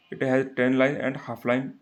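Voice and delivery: very slow, each word spelled out for notes; slow dictation. Shown as a demonstration. Teacher dictating the line for students, very slow. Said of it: "teacher dictating".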